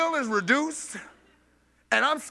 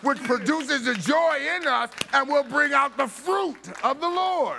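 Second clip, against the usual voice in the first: louder, rising preacher's climax; full-force preaching; 4 semitones higher, 4 dB louder than usual. Acted. Preacher tells the congregation joy fills the room, preaching loudly.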